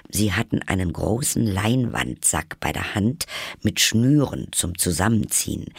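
usual speaking voice

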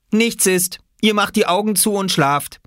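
high, bossy big-sister voice. A narrator's reading. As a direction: lay down the law like an older sister. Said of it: "Das war Jule".

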